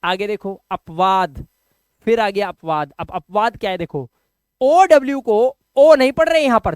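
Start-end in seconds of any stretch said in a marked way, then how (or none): 4.6-6.8 loud, high teacher emphasis; emphatic loud. Real, not acted. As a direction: push it out to grab attention. Teacher stresses exception loudly. (ज़ोर देकर ऊँची आवाज़ में)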